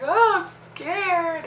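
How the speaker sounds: high, drawn-out mock wail; slow high-pitched whine of fear; retold scared scream. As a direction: re-enact frightened wailing, stretching the words. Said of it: "Screaming like".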